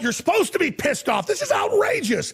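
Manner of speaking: outraged, pitch jumping high; pissed off. Real, not acted. Host insisting listeners should be outraged, angry outburst. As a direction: burst out in high-pitched indignation. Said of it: "I'm so pissed off".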